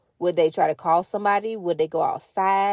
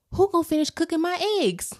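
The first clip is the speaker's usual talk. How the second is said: put-on high, startled little boy; gasp, then blurt it out like a hungry child; kid's sudden alarm; 10 semitones higher, 3 dB louder, faster than usual. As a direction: son suddenly remembering his breakfast. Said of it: "all of a sudden he was like".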